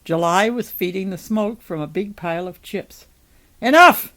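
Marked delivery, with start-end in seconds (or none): 3.6-4.1 high, very loud single-word outburst; shouted command to stop; loud cry. A narrator's reading. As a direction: cry it out sharply to cut them off. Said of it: "cried Noah".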